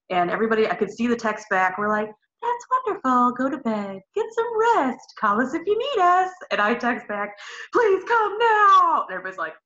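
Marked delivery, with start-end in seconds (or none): 2.4-6.3 breezy reassuring. Retold or imitated. Birth team text quoted cheerily. 7.7-9.0 high, slow, tearful plea; crying plea. Retold or imitated. Desperate text, crying heard.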